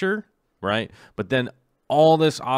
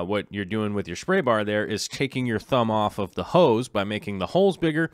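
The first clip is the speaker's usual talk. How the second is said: ordinary talk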